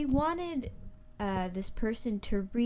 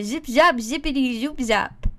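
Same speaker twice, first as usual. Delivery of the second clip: mock comedian catchphrase, louder; 7 dB louder than usual. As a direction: punch out the silly catchphrase. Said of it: "here's his catchphrase".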